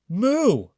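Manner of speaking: high, loud moo; a triumphant cow moo. A narrator's reading. Cow celebrates, a loud high moo. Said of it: "Then the cow said".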